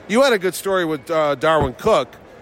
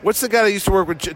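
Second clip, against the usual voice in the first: usual speaking voice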